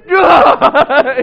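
loud, high comic groan; exasperated groan. Retold or imitated. Dad reacting to daughter's protest, loud mock groan. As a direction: groan loudly through laughter.

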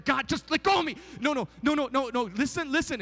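wriggle free while protesting; squirming protest. Acted. Sinner wriggles out of God's hug, flustered protest.

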